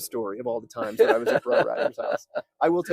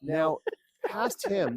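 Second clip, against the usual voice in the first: ordinary talk, nothing marked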